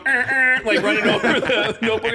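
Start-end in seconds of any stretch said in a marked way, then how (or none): 0.0-0.6 imitated brakes. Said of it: "taxis are going".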